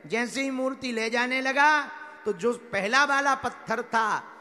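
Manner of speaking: loud, projected parable telling; rousing preacher storytelling, punching phrase ends; full-force preaching. Acted. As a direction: drive the story out to a big congregation.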